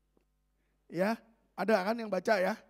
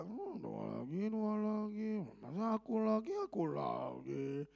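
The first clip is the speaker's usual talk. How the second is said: grumbling; 7 dB quieter, slower than usual. Retold or imitated. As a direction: moan as if asked to pray yet again. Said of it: "orang yang bersungut-sungut".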